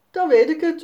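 put-on children calling out together; called out. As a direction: shout out the discovery. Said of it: "roepen Jasper en Sanne".

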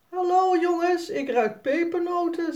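vrolijke Piet. A narrator's reading. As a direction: greet the kids like a jolly Piet. Vrolijke Piet komt binnen. (hoge, luide vrolijke Piet)

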